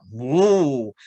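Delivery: loud mock bellow of a bull. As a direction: boom it out like an ox calling. Ox shouts to court ant.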